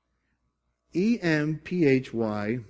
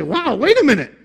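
much higher, louder burst of amazement; amazed discovery; 9 semitones higher, 6 dB louder than usual. Retold or imitated. Preacher re-enacting his own study discovery, amazed. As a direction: react as if you just found a rare treasure. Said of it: "whenever I see something like that".

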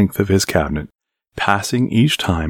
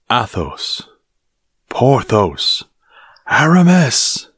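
from commanding to angry, rising up to a high shout; calling louder each time; 6 semitones higher, slower than usual. A narrator's reading. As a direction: call three names, each one louder. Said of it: "with a louder voice at each time".

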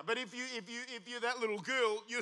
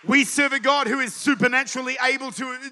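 loud, high climactic preaching shout; preaching at full force; 4 semitones higher, 13 dB louder than usual. Acted. Pastor shouts to his Sunday congregation, full-force preaching. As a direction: build it up and shout it to the church.

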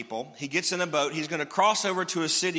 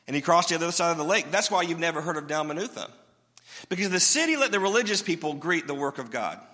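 ordinary delivery

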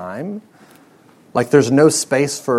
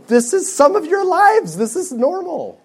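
much higher, pushing the point home; emphatic rise; 13 semitones higher, 3 dB louder than usual. Real, not acted. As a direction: lift the voice high to stress this is normal. Preacher emphasising busy lives.

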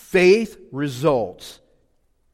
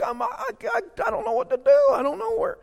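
put-on much higher stammering kid; whiny kid. Pastor as a boy whining to his parents, much higher. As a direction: whine it like a hurting twelve-year-old. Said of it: "I said".

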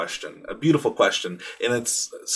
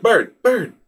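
put-on much higher, louder toddler voice; imitating toddler; 11 semitones higher, 8 dB louder than usual. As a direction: chirp it like an excited little kid. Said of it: "and he said".

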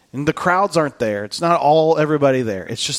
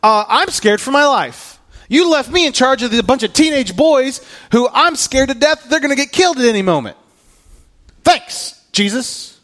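high, fast, panicked complaint to Jesus; scared Peter; 10 semitones higher, 4 dB louder than usual. Retold or imitated. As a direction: sound like a man scared for his life. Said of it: "He goes".

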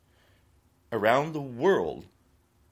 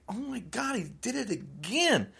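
higher, hushed gasp of disbelief; a stunned "oh my God", pitched up and soft; shocked disbelief; 8 semitones higher, 3 dB quieter than usual. Retold or imitated. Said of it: "And I'm like".

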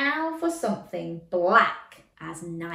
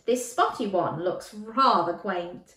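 usual speaking voice

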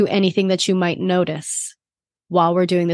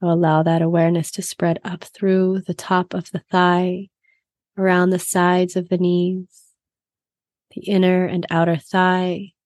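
guided meditation. Acted.

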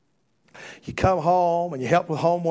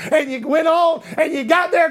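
higher and louder, building to a shout; climbing preached shout; rising preacher shout; 10 semitones higher, 5 dB louder than usual. Acted. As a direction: drive the crowd up toward the climax.

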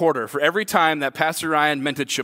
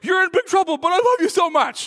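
angry yet relieved; 12 semitones higher than usual. Retold or imitated. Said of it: "I was so angry, but I was so relieved".

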